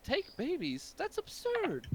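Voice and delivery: high, scandalised merchant voice; indignant shopkeeper. Acted. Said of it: "He says".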